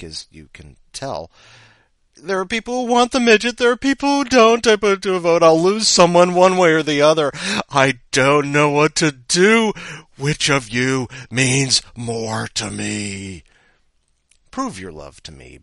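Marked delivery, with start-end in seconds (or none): 2.2-13.4 melodramatic wail. Acted. Mock melodramatic dilemma.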